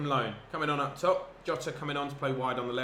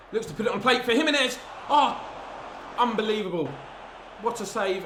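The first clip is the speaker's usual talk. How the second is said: loud, high commentary yell as the chance comes; rising excited roar at a near goal; excited shout; 9 semitones higher, 5 dB louder than usual. Real, not acted. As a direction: shout it like the ball's about to go in.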